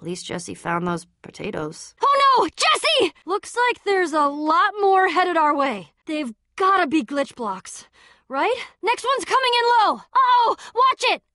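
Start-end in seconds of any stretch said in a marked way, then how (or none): 2.0-5.6 high panicked call; alarmed shout. Acted. Danger, calling friend. 8.8-11.2 high, fast battle calls; urgent warning shouts. Acted. Warning during attack.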